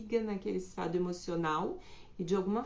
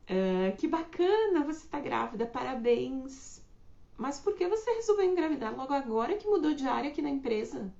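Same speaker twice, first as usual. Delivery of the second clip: high, louder sugary fake praise; mock fake congratulations; 5 semitones higher, 4 dB louder than usual. Colleague at work fakes praise, then pries, high and sugary. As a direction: congratulate sweetly, then pry.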